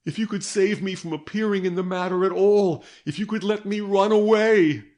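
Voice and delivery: pleading on her knees, rising in panic; desperate pleading. A narrator's reading. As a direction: beg for escape, voice climbing. Girl begs on her knees.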